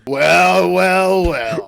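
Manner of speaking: loud menacing well well well; villain bully voice. Acted. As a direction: growl it like a schoolyard bully.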